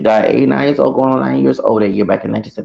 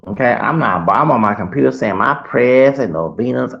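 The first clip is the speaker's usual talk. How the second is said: usual speaking voice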